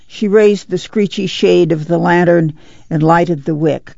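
usual speaking voice

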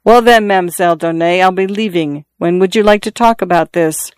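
usual speaking voice

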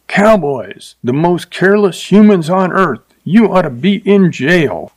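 high mock scream at the cowboy. A narrator's reading. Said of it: "She looked at him and screamed".

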